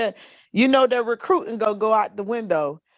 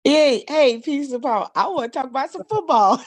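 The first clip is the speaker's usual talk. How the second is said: excited greeting; 7 semitones higher than usual. Real, not acted. Cheerful greeting joining the stream.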